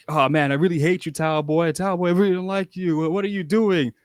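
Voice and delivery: mock heckling crowd. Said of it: "everybody was like".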